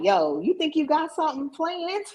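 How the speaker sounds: high-pitched teasing challenge; God. Retold or imitated. God teasing her about her plans, playful and high. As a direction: playfully call someone out, voice jumping up. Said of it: "God really be like".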